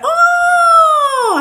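high, loud, drawn-out "oh!"; imitated scream. Retelling mum's cry at the door, imitated shriek. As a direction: let out a long, high mock scream. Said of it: "I heard her go".

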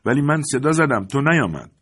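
defensive. A narrator's reading. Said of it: "روبرتو از خود دفاع کرد".

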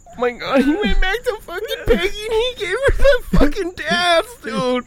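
high mock-sobbing wail; exaggerated weepy outburst; crying. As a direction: blubber it out in a squeak. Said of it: "I'm still fucking crying from that part".

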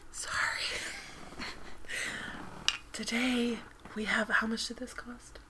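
breathy whispered ASMR read. Acted. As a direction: whisper softly into the mic. ASMR Corner whisper segment.

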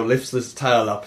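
ordinary talk

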